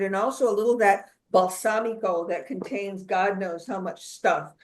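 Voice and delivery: usual speaking voice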